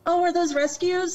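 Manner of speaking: high-pitched mock cooing question; imitated admiring curiosity. Passer-by asking an owner about greyhounds, mock admiration. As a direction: ask it way up high, as an eager admirer.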